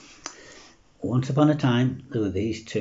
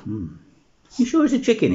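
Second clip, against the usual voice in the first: high-pitched, doubtful vet's query; puzzled; 10 semitones higher, 5 dB louder than usual. Acted. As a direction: ponder with a hum, then question it in a raised voice. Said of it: "He looks puzzled and he scratches his head".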